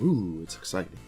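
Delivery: ordinary delivery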